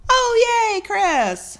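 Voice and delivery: high happy cheer; excited. Real, not acted. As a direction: cheer for a friend. Cheering a viewer's message.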